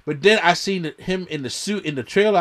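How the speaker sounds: ordinary talk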